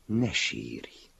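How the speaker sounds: soft, low, soothing voice; gentle. A narrator's reading. Master comforting a sobbing man, gently. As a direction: hush them tenderly. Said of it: "folytatta szelíden a mester".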